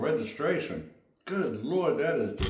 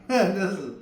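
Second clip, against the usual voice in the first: laughing; 6 semitones higher, 3 dB louder than usual. Real, not acted. Laughing while tasting.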